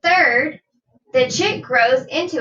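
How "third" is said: usual speaking voice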